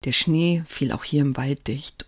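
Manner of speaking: usual speaking voice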